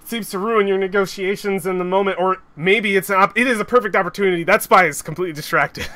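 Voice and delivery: fast excited narration. Real, not acted. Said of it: "excited DM narration".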